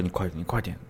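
hushed, urgent whisper into his ear; soft whispered prodding. Retold or imitated. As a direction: urge him on under your breath. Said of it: "我就说".

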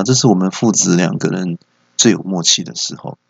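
ordinary talk

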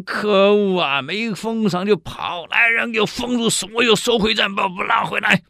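high, loud furious orders; emperor's rage. Acted. The emperor orders guards to bring him back, angry and loud. Emperor raging at missing minister.

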